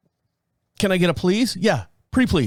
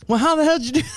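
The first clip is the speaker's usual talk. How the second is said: movie character; 11 semitones higher, much faster than usual. Retold or imitated. Acting Pulp Fiction scene.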